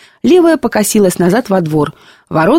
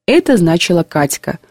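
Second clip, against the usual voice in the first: plain reading voice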